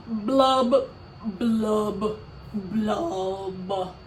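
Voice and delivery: mock sobbing blub blub blub; hammed-up fish crying noises; dramatic blubbing. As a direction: fake weepy blubs, fading. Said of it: "He is a very dramatic pout pout fish".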